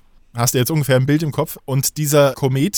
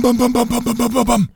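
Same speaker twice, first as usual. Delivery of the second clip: higher, rhythmic bam bam bam; bumping down sounds; 11 semitones higher than usual. Retold or imitated. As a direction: mimic sliding down the stairs step by step. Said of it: "Und dann bin ich bam". (rhythmisches, höheres Bam-Bam als Treppensturz)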